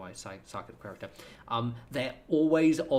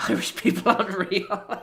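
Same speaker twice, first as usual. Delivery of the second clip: high, loud, laughing outburst; 9 semitones higher, 10 dB louder than usual. Real, not acted. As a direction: burst out laughing through the words. Cracking up at chat's remark.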